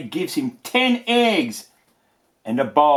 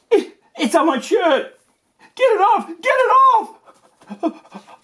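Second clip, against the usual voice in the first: high, loud panicked squeal, faster at the end; 6 dB louder than usual. Acted. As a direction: shriek like the egg is alive. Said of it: "You can see that's the way he's talking".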